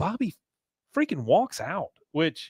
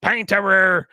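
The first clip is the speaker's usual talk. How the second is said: high, loud mock Dale; a nasal-free cartoon Dale bark; imitating Dale; 8 semitones higher, 5 dB louder than usual. Dale quoted in a higher, louder voice.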